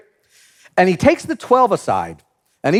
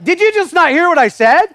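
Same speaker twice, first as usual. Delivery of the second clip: raised, incredulous "did you even listen"; exasperated retort; 9 semitones higher, 4 dB louder than usual. Pretend. Preacher imagining his own reply to the disciples, exasperated. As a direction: snap back in disbelief, higher and louder. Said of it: "'cause I'd be like".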